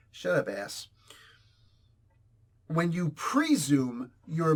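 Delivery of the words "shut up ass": mock dismissive retort. Snapping at imagined critic.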